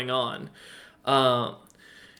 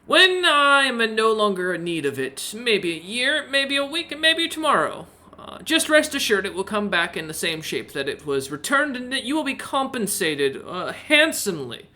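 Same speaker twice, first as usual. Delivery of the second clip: merchant voice; 7 semitones higher than usual. Acted. Merchant bargaining.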